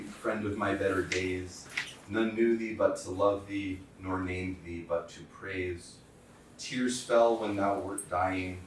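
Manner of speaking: slow, solemn recital of an elegy; poem reading. Acted. Lecturer reading a poem for his dead friend to students, slow and solemn. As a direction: read each line slowly, letting the grief sit. Reads memorial poem aloud.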